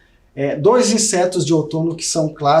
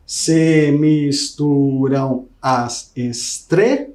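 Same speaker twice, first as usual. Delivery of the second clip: syllable counting; 3 semitones lower, much slower than usual. Shown as a demonstration. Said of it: "No segundo tem sete".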